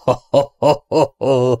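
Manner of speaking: coarse jeering laughter; rough mocking laugh. Ellen scoffing at Robin's plan, rough mocking laugh. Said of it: "Ellen durván felkacagott".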